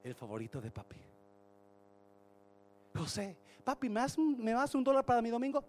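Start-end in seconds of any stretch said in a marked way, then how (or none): 2.9-5.6 put-on high, loud pleading kid voice; begging child. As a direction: wheedle papa for money. Hijos pidiendo dinero al padre. (niño suplicando agudo al papá)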